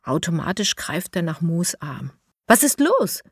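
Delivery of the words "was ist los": startled. A narrator's reading. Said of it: "fragt Mo erschrocken".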